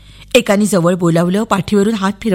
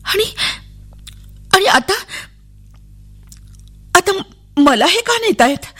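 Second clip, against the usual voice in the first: halting, high-pitched anxious question; frightened child; 10 semitones higher than usual. A narrator's reading. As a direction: falter between words, then ask higher. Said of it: "मी घाबरून गेले". (घाबरून, अडखळत विचारलेला प्रश्न)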